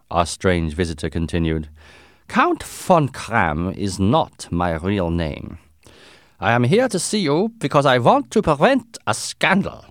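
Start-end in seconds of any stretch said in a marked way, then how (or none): 2.3-9.8 put-on strong German accent. A narrator's reading. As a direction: Count with a deliberate, heavy German accent. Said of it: "He had a strong German accent".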